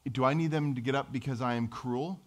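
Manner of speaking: usual speaking voice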